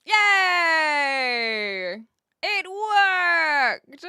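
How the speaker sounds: loud, high whoop of triumph; real joy at the bot working; delighted cheer. Real, not acted. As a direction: cheer like the code finally ran. Bot command finally works.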